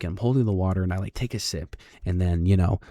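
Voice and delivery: normal talking voice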